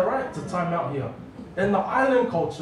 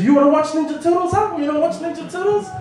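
higher, fast menacing taunt; angry mother taunting her son; mum's fast threat; 8 semitones higher, 3 dB louder, faster than usual. Retold or imitated. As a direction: rattle it off, ready to strike. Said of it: "And she goes".

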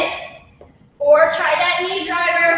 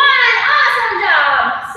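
excited praise; 5 semitones higher, 5 dB louder, much slower than usual. Real, not acted. Coach cheering class after set.

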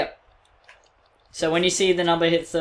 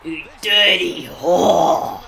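very slow, stretched-out playful insult; drawn-out mock insult; much slower than usual. Friend trying out the translated insult, drawn-out mock cry. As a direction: drag the insult out theatrically.

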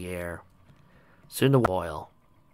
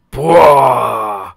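loud, drawn-out playful monster bellow; pretend behemoth roar; 3 semitones higher, 15 dB louder than usual. Grandfather playing behemoth for clinging foals, mock roar. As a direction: roar hugely like a play monster. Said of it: "sounded out as he pretended to be a behemoth".

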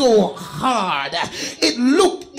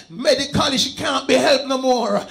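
normal talking voice

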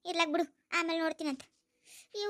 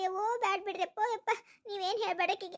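ordinary delivery

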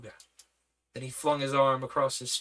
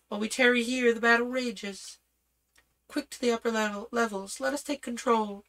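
princess voice; 9 semitones higher than usual. Acted. Leela urging husband in battle.

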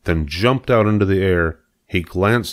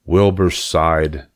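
usual speaking voice

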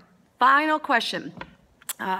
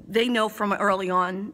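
usual speaking voice

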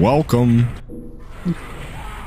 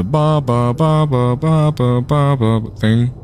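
higher-pitched repeated "ba" syllables; meme imitation; 4 semitones higher, slower than usual. Streamer describing a meme to friend, higher imitated bas. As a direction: mimic the meme guy's string of bas. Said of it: "the guy that did, like, the".

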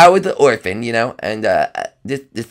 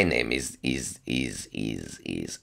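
much lower, sinking into a slow drag; put-on strange voice; 12 semitones lower, 15 dB quieter, much slower than usual. Doctor greeting visitors in a fake voice, deep and fading. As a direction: stretch it out, deeper and softer each time. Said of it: "why are you talking like that? That's not your voice".

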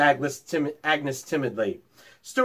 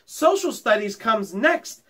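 keen, raised, a touch excited; eager; 8 semitones higher than usual. A narrator's reading. Schoolgirl tells the teacher the next subject, lightly eager. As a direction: pipe up eagerly. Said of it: "cried Elizabeth Gardner eagerly".

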